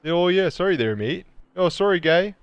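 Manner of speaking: put-on high, lilting caricature Canadian accent; mock Canadian. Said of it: "Is T Barnes Canadian?".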